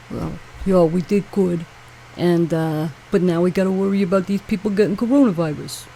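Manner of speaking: a lightly put-on New York accent, mocking; lazy New York accent. Vlogger mocking the New York governor's speech, in a New York accent. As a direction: mimic a droning New York politician. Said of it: "I do a much better New York accent".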